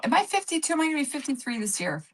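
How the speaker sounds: usual speaking voice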